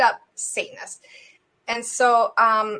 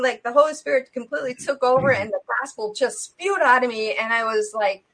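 normal talking voice

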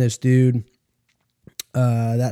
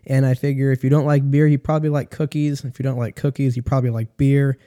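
usual speaking voice

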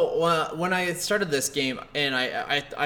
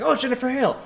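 higher-pitched retold delight; excited recognition; 10 semitones higher, faster than usual. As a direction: exclaim it as you recognize a favorite. Acted delighted reaction on recognizing voice.

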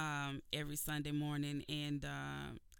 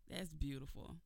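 ordinary delivery

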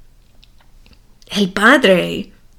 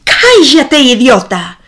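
louder, higher narrated shout; a read-aloud yell, lifted and louder; 3 semitones higher, 5 dB louder than usual. A narrator's reading. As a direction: snap it out as the boy yells at his father. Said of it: "el hijo grita".

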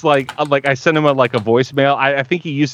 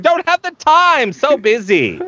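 much higher, slow, haughty brush-off; hammed-up celebrity dismissing fans; mock diva; 14 semitones higher than usual. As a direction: play the too-busy star.

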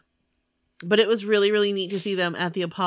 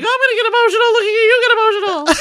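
much higher, louder, fast, laughing through it; laughing, teary quote; 11 dB louder, much faster than usual. Retold or imitated. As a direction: squeal it out while laughing and half crying. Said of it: "And you're like".

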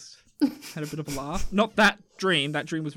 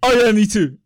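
mock chiming in; 4 semitones higher, 9 dB louder than usual. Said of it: "you're gonna cut in and go like".

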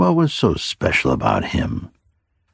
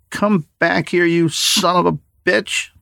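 put-on much higher boy's voice, yelling after him in fury. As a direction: shout after the fleeing old man, high and angry. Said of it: "yelled the teenager".

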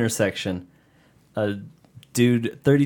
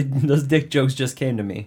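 ordinary talk, nothing marked

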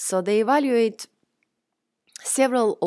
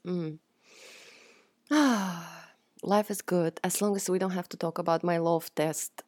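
ordinary talk, nothing marked

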